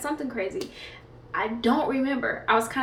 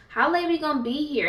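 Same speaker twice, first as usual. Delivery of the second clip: higher, louder mocking of mom's impatience; mock impatient mom; 5 semitones higher, 4 dB louder than usual. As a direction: whine like an impatient mother.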